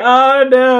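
hammed-up high-pitched dismay; mock panicked wail. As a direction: wail it in playful embarrassment. Retold panic at own crying.

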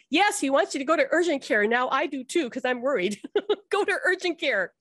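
urgent insistence. Retold or imitated. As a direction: push her to go right now. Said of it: "And she goes".

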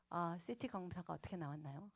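soft, low, courteous question; polite. Retold or imitated. Patient phoning the doctor for scan results, polite. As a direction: ask meekly and respectfully. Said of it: "공손하게".

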